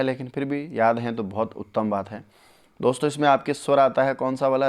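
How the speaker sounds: ordinary talk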